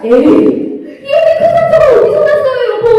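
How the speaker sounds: a wife startled by her husband's injury; worried wife. Acted. Wife sees husband's wound. (남편 상처를 보고 놀란 아내 목소리)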